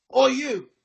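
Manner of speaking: guard hailing someone across the hall; guard's call. Acted. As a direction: call out in sudden recognition.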